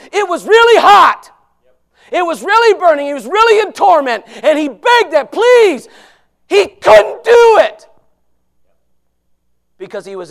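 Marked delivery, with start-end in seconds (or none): 0.1-7.9 loud, higher, hammering really; preaching at full force. Acted. Preacher thunders to the congregation about the rich man's suffering, loud. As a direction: drive home the torment of hell.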